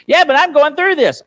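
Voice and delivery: loud, high mock objection; imitated protest. Mimics a listener's objection.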